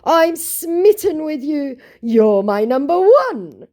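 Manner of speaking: high, loud, drawn-out mock adoration; hammed-up slow gushing; smitten scammer. Scammer says he is smitten with her, hammed-up adoring voice. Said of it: "He continued".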